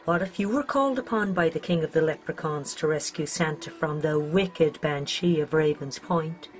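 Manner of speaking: ordinary delivery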